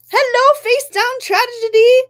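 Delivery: high, loud cheery hello; bright greeting. Real, not acted. Greeting a new chatter, high and loud. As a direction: sing out a welcome to the newcomer.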